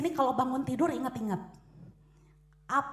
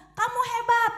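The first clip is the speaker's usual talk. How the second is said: much higher, louder praise; loud cheer; 10 semitones higher, 6 dB louder than usual. Retold or imitated. Shouting praise into the echo, high and loud. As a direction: call it out high and proud. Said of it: "kalau kita ngomong".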